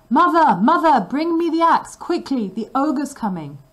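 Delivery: put-on boy shouting to his mother, panicked. A narrator's reading. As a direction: yell for help in panic.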